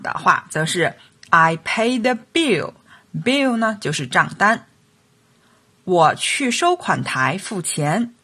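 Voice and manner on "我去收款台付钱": ordinary delivery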